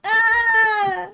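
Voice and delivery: high, loud, sustained scream; long high-pitched cry; acted scream. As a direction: scream as in a blast.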